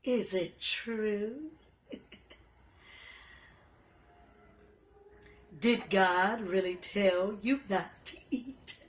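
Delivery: slow, sly, sneaky voice with odd timbre; sly serpent. Acted. Tricky serpent coaxing Eve in the garden, slow and sly. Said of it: "a tricky serpent said to her".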